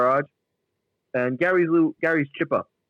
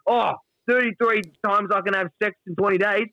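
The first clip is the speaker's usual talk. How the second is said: mock Duck boast; 6 semitones higher than usual. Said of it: "what Duck says".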